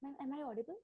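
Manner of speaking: faint voice, far from the mic; so faint it barely carries; barely audible. Real, not acted. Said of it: "your voice is very low".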